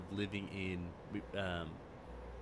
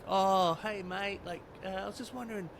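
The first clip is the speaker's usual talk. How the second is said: put-on Australian accent, pitched higher; 11 semitones higher, 3 dB louder than usual. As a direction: lay the Australian accent on for the server. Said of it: "I'm gonna use my Australian accent".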